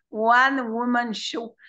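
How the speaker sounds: careful, drawn-out "one-woman show" in a French accent; application laborieuse. Real, not acted. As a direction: say "one-woman show" slowly, word by word, wrestling with the English. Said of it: "c'est impossible à dire". (« one-woman show » prononcé lentement, avec application, à la française)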